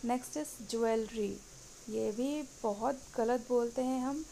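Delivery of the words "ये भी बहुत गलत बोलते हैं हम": ordinary delivery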